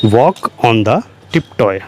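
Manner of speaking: each word spaced out slowly for the class; slow dictation. Shown as a demonstration. Teacher spelling out an English sentence for learners, slow and deliberate. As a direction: dictate it slowly so students can follow. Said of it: "slow dictation of English phrase".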